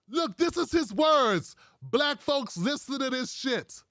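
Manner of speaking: normal talking voice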